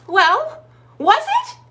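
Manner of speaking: very high, loud eager question; dog demanding an answer at the tree, very high and loud. Acted.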